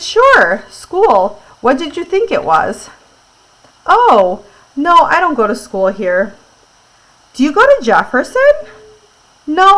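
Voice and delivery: read-aloud with lively acted dialogue; animated story reading. A narrator's reading. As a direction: make each question and answer jump out.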